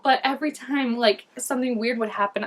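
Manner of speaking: usual speaking voice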